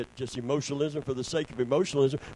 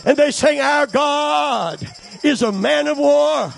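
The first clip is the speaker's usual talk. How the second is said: high, forceful pulpit oratory; full-force preaching; 10 semitones higher, 7 dB louder than usual. Real, not acted. Preacher telling of Miriam and Israel's victory, full-force preaching. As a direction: build the story into a shouted proclamation.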